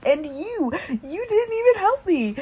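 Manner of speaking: high-pitched mock outrage; dramatic accusation. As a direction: play up the betrayal, voice leaping up. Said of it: "I'm being dramatic now".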